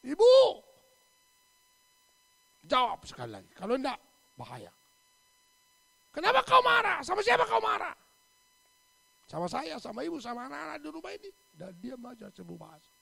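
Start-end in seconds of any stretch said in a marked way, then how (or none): 0.1-4.7 loud stern ultimatum; stern warning. Retold or imitated. Pastor loudly warning the widow to answer. 6.1-7.9 furious shouted question; angry shout. Retold or imitated. Angry pastor shouting at the silent widow. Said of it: "Marahlah Pak Pendeta". 9.3-11.3 angry tirade. Retold or imitated. Pastor shouts at widow.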